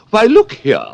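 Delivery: high, loud exclamation; queen cries out. Acted. Red Queen explaining to Alice, high loud cry. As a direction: cry it out impatiently. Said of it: "the Red Queen cried".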